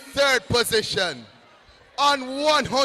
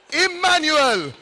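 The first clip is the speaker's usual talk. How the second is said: announcer shout; 5 semitones higher, 6 dB louder than usual. Real, not acted. Announcing team name loudly.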